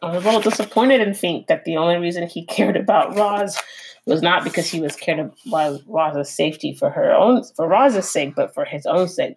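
plain reading voice

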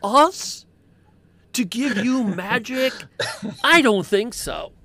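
loud, high Brooklyn tough-guy elf scolding; a Brooklyn wiseguy elf telling off a trespasser. Acted. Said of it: "I've always loved the idea of elves sounding like they're from Brooklyn".

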